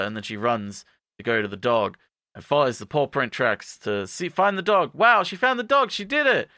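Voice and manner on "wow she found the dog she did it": high, excited mock cheer; mock celebration. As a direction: celebrate with exaggerated, high-pitched wonder. Mock celebration of finding dog.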